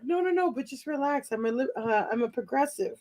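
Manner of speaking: hushed, soft backpedaling; placating date. Retold or imitated. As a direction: soothe them quietly while stumbling for words. Date placating her, acted.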